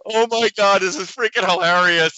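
high and loud through laughter; barely holding it together; laughing. Real, not acted. Laughing at the video.

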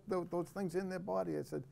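normal talking voice

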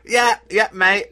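high, loud put-on British reply; cheeky overdone Brit; mock British accent. Answering co-host about the accent, in mock British. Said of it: "you doing a British accent".

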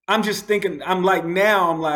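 ordinary talk, nothing marked

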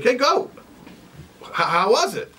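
owl flying up, keen to hear; eager owl. Acted. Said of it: "and he's like".

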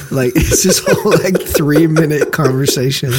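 amused, laughing as you speak. Real, not acted. Co-host recounting a funny interview prank, laughing.

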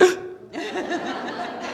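sharp mock gasp; sudden high, soft intake of breath; shocked gasp. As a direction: gasp in stunned silence at a scolding. Reacting to the priest's rebuke.

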